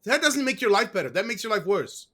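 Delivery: usual speaking voice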